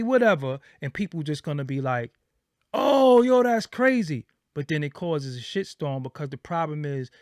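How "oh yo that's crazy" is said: high, loud gushing; mock impressed listener. Mocking fans who cheer bold interview claims, loud and high. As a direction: play the listener who buys any wild claim.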